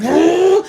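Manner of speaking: high, loud "whoa" whoosh; imitated zooming car. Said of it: "he just starts zooming".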